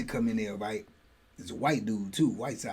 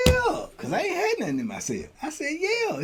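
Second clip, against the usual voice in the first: high nervous yeah; 13 semitones higher, 5 dB quieter than usual. Retold or imitated.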